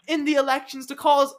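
high, hammed-up British accent as a future prime minister. Podcast host playing a future British prime minister, hammed-up British accent. Mock British PM character bit.

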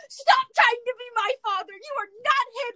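high, loud mock tantrum; flipping out. Daughter flips out at her stepfather, shrill mock tantrum. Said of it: "she flips out and goes".

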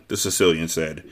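usual speaking voice

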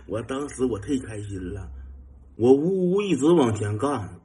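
ordinary talk, nothing marked

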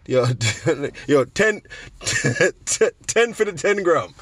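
high-pitched haggling, broken by laughter; laughing mock haggle. Buyer bargaining with a Jamaican weed man, laughing mock haggle. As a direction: crack up while acting out a deal.

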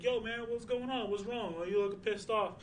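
lightly voiced worried buddy; mild caring call; concerned friend. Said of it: "somebody's like".

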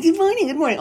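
very high mock-chirpy greeting; squeaky, hammed-up cheeriness; annoyingly perky greeters. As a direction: imitate chirpy morning people. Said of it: "people are like".

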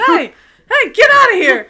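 loud, high shout to scare it off; yelling. Pretend. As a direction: bellow to chase something away. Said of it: "Waved your arms around and yelled".

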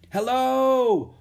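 high, loud, long hail; shout. A narrator's reading. Rose hails the people at the academy, shouting. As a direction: shout across the water. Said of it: "Rose shouted".

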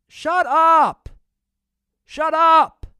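loud, fed-up shout; angry shout. Real, not acted. Vlogger yelling at overreacting fans, angry shout. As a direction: yell it at them, exasperated.

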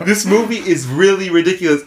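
ordinary talk, nothing marked

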